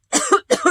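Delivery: sudden hard coughing; coughing fit. Real, not acted. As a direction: cough out a swallowed bug. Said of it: "sorry, I just swallowed a bug".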